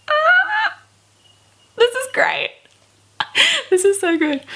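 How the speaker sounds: high, giddy squeals with laughter; squealing delight. Real, not acted. Vlogger overjoyed at Bella asking Jessica to dance, squealing. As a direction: squeal with glee between giggles.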